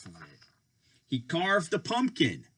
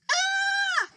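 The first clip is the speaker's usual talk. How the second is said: high shrieking scream. Acted. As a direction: shriek in fright. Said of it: "Skeleton screamed".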